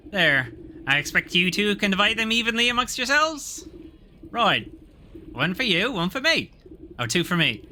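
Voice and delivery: squabbling guards. Acted. Guards squabble over coins.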